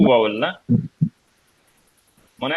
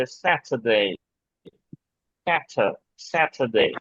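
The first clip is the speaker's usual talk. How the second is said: slow, crisp British Saturday with a clear t; British accent; 2 semitones lower, much slower than usual. Shown as a demonstration. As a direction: model Saturday the British way, t fully sounded. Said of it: "ব্রিটিশরা বলে".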